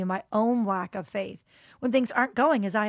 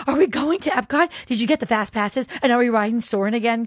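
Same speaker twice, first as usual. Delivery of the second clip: high, loud, eager questions from excited friends; excited like kids; 4 semitones higher, 7 dB louder than usual. Retold or imitated. Friends in the car asking about Epcot, excited. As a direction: bounce with childlike excitement. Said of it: "they were so excited".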